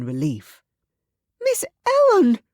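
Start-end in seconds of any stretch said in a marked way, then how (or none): 1.4-2.4 very high, loud frightened cry; shriek. Acted. Susan pushing through the crowd, shrieking. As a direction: shriek her mistress's name. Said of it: "Susan shrieked".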